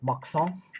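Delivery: put-on Maxence in a light French accent. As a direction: say Maxence the French way, nasal ending. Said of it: "he would say".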